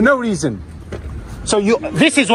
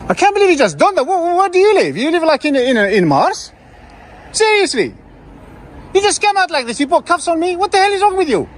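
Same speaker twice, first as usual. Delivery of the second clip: high, indignant ranting; outraged; 6 semitones higher than usual. Real, not acted. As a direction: vent disbelief at the officer. Outraged rant at officer.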